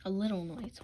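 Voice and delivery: ordinary delivery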